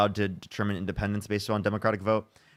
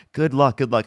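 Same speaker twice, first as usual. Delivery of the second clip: ordinary talk, nothing marked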